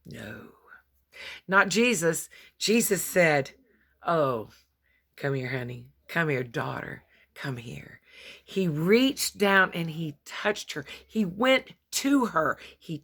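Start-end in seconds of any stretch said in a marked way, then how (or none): 4.1-8.0 tender call. Acted. Jesus calling the bent woman.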